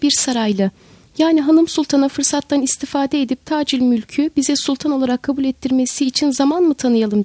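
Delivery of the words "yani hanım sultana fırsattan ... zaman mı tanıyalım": ordinary delivery, nothing marked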